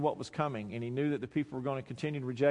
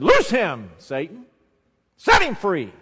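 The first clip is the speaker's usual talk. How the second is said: loud, high-pitched order to Satan; thundering command to release a captive; commanding shout; 5 semitones higher, 12 dB louder, slower than usual. Retold or imitated. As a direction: fire each command out with full force. Jesus' command re-acted at Satan.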